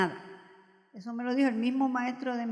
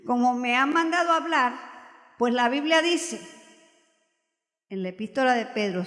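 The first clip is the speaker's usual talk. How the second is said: normal talking voice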